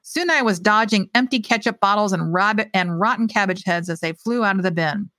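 ordinary delivery

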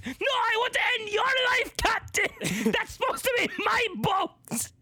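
high shouted hammed-up menace; skipper's mock fury. Skipper rants at captain over the boat, shouting high. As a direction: bellow it like a comic villain.

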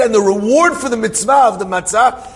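high-pitched, impassioned bargaining; pleading offer. Retold or imitated. Friend offers his reward for matzah, pleading. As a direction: offer it urgently, as a retold plea. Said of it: "He's begging, pleading".

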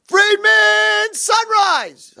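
high, booming call; wake-up shout. Acted. Lefkowitz wakes his roommate at five o'clock, wake-up shout. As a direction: holler him awake at the top of the voice.

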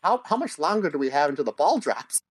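ordinary delivery

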